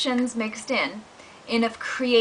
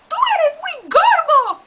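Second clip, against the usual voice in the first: much higher, louder cat; squeaky cat; 6 dB louder than usual. Acted. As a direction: squeal it back. Cartoon fight continues.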